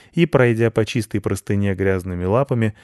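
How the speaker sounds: ordinary delivery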